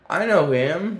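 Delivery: retold burst of recognition, pitched high; light up with sudden recognition; excited recognition. Telling co-host about spotting the singer, excited recognition.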